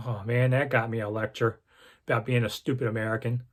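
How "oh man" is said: normal talking voice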